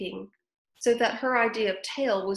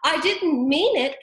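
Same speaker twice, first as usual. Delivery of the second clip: higher, louder, slow plea; pleading Alice; 8 semitones higher, 8 dB louder than usual. A narrator's reading. Alice pleading with the mouse, a touch of pleading. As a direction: beg gently not to offend. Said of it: "pleaded poor Alice".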